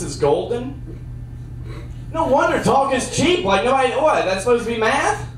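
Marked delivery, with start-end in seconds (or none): none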